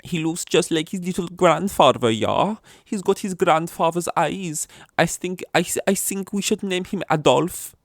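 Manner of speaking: put-on high, loud German accent drifting Scandinavian; hammed-up, badly faked German accent; wobbly German accent. German parent choosing the baby's name, in a wobbly German accent. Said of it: "It's going Scandinavian again".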